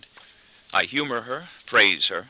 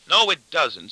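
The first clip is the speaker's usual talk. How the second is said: plain reading voice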